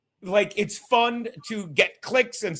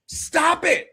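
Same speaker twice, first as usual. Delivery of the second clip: ordinary delivery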